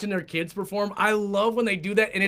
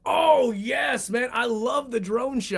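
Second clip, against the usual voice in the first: loud, higher burst of real enthusiasm; excited agreement; 5 semitones higher, 4 dB louder, slower than usual. Real, not acted. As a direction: jump in delighted, voice raised. Delighted agreement about drone shots.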